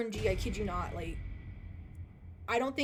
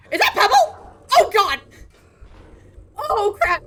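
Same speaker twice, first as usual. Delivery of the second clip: loud, high surprised yell; shocked shout; 9 semitones higher, 13 dB louder than usual. Real, not acted. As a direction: blurt it out in shock.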